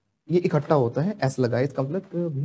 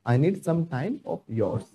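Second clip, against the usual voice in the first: very slow, drawn out; slow model sentence; 3 dB quieter, much slower than usual. Shown as a demonstration. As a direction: give each word room. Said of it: "teacher models sentence for class".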